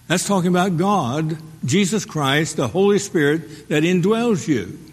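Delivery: ordinary delivery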